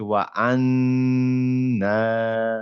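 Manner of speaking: আন্না with long humming n, then long aa; held nasal and vowel. Shown as a demonstration. Teacher showing two steps, আন্না drawn out twice. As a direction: slowly stretch both the n and final vowel of আন্না. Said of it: "সেটাকে আবার একটু টান দিতে হবে".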